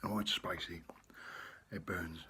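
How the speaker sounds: breathy whisper after a hot bite; whispered gasp from spice. Real, not acted. Reacting to spicy noodles.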